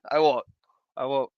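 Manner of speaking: ordinary delivery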